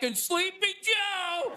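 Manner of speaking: slow, high, drawn-out taunt; mocking taunt. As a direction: stretch it out like a jeering nickname. Acting out Trump's taunting shout.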